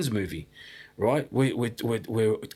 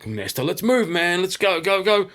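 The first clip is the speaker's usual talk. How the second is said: higher and louder, hurried calls to go; urgent shout; 8 semitones higher, 5 dB louder than usual. Retold or imitated. Fleeing snakes at dusk, urgent loud call to the friend. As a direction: press a friend to run with mock fear. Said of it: "So we're going".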